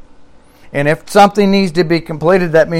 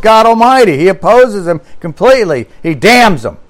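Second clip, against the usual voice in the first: higher and louder, full-force pulpit preaching; fiery preaching; 7 semitones higher, 5 dB louder than usual. Acted. Preacher drives home God's opposition to idols in fiery preaching. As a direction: thunder it out to the congregation.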